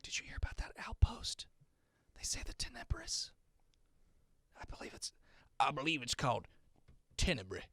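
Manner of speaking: unvoiced, hushed conspiratorial whisper. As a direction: whisper it like a secret. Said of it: "you can hear them whispering about".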